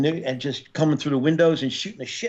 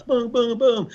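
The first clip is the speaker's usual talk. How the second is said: mock gunshots; 9 semitones higher, slower than usual. Imitating gunshots.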